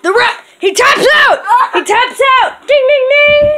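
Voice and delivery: high excited call of the tap-out; wrestling commentator. Pretend. Calling the end of match.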